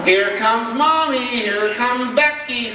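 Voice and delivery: high, slow singsong taunt; slow, high sing-song child taunt; child's singsong. Retold or imitated. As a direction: sing it out like a cheeky little girl. Child sings out warning, thinking mom.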